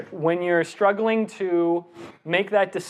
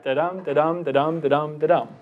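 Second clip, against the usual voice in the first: five even beats, each "dum" stressed after a light "da"; metered "da-dum" demo, stress on every second syllable; iambic rhythm; 2 semitones lower than usual. Shown as a demonstration. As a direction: chant "da-dum" in steady weak-strong pulses. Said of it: "Some things are stressed".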